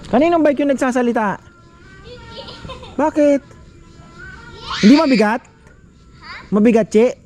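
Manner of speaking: calling out. Real, not acted. Asking others about the bike, calling out. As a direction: call over in a high, carrying voice. Calls questions to others off camera.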